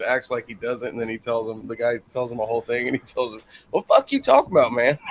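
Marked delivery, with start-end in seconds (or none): 3.7-5.0 mock Southern twang retort; comedian's retort. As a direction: snap it back like the comedian. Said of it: "That's the way he talks".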